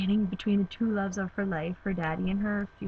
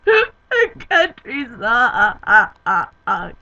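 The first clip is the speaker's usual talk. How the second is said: mock sobbing; 2 semitones higher, 11 dB louder, slower than usual. Imitating her own sobbing.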